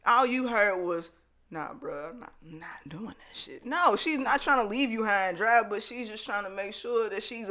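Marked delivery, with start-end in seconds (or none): 1.5-3.6 low, very soft brush-off; mock dismissal. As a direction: mumble a refusal, imitating. Voices the refusal he heard.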